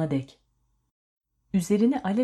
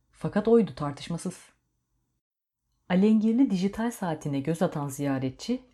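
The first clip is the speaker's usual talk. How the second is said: ordinary delivery, nothing marked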